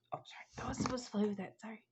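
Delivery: hushed unvoiced apology; real whisper. Real, not acted. Whispering an apology to someone off camera. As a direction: whisper the sorry so the room stays quiet.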